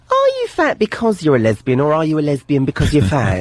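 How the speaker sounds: high-pitched character voice, opening highest; bigoted sketch character. Acted. Sketch character quizzing Rosie O'Donnell, high put-on voice. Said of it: "She says to Rosie O'Donnell".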